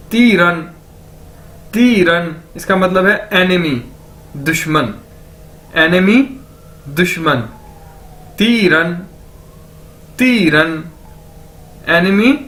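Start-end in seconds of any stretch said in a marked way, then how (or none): 0.1-2.4 तीरन with a long ती falling into रन; pronunciation demo. Shown as a demonstration. Teacher joins both syllables for learners, pronunciation demo. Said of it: "इन दोनों को इकट्ठा कर दिया जाए". 8.4-10.8 तीरन with a long ती falling into रन; pronunciation demo. Shown as a demonstration. Teacher joins both syllables for learners, pronunciation demo. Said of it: "इन दोनों को इकट्ठा कर दिया जाए".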